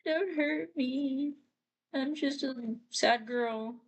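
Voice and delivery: put-on timid high sad-girl plea. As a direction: beg him not to hurt her. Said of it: "April's like".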